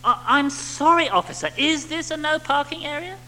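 목소리를 높이고 천천히, 비굴하게 경찰관에게 사과하듯; grovelling. Retold or imitated. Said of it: "suitably grovelling attitude".

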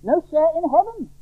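high-pitched stern warning; urgent, emphatic pronouncement; uncompromising warning. Acted. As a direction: press the warning home, voice high. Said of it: "is an uncompromising warning".

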